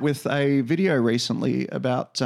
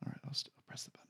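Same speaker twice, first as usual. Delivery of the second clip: whispering; 23 dB quieter, much faster than usual. Real, not acted. Whispering near end of recording.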